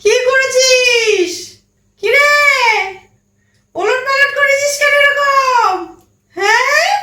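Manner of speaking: high, drawn-out exasperated scolding; angry scolding. Acted. Mother to child over the upturned house, exasperated scolding. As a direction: wail each question in exasperation.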